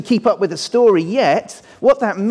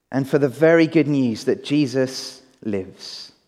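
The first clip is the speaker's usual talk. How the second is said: prayer; 7 semitones lower than usual. Acted. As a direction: pray gently for the whole church. Pastor leads congregation in prayer.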